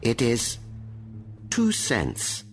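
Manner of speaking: high, hesitant admission with pauses; fearful. A narrator's reading. The frightened young man admitting the price, fearful. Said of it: "young man's face shows more fear".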